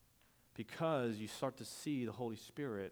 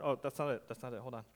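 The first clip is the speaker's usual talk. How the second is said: normal talking voice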